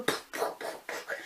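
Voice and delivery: voiceless puffed punch noises; imitated fight impacts, all breath; punch sound effects. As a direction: puff out each blow.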